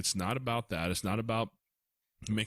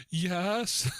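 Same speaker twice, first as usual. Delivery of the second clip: put-on little boy's yes; 9 semitones higher, 4 dB louder than usual. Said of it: "And he said".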